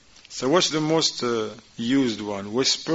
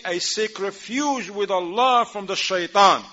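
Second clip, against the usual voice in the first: much higher and louder, a strong declaration; raised, emphatic; 8 semitones higher, 4 dB louder than usual. Shown as a demonstration. Teacher models the believer's answer, raised. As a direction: proclaim it with conviction. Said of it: "And you say".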